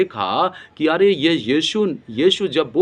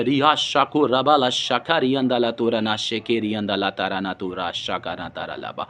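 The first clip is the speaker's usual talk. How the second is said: low chant, sinking softer to the end; praying in tongues; 5 semitones lower, 3 dB quieter than usual. Real, not acted. Preacher slipping from teaching into low, fading tongues. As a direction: let the run of syllables drop away quietly.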